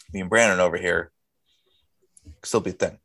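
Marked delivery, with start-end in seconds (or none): none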